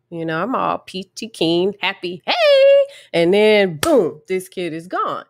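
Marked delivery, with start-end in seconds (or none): 2.3-2.9 very high, loud cheery hey; bright greeting. Retold or imitated. Therapist greeting the child at the door, bright and loud. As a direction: sing out a happy hello.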